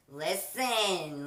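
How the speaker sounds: loud and firm "Listen"; firm call. Retold or imitated. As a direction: snap it like telling off a pet. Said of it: "I used to tell her".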